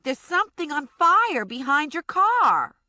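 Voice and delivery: high and loud call to the car. Acted. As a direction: shout across to the street. Connie calling to the car.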